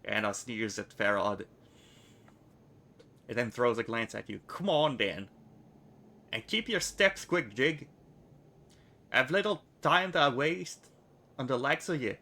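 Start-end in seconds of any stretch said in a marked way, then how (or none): 4.5-12.2 put-on higher, a brash girl hurrying him along; bossy, hurry him up; impatient order. Anna ordering the newcomer to keep up, higher.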